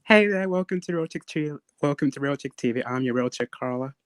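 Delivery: ordinary talk, nothing marked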